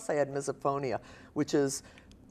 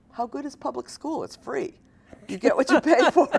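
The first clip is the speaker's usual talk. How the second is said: higher-pitched dismissive immigrant parents; skeptical parents; 10 semitones higher, 6 dB louder than usual. Retold or imitated. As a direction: imitate parents scoffing at something free. Voicing parents' dismissive thought.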